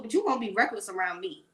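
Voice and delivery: usual speaking voice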